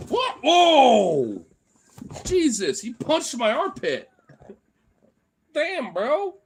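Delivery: real high, loud yelp of shock; jolted, high-pitched protest; startled. Real, not acted. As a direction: cry out as if suddenly hit. Dog punches his armpit during play.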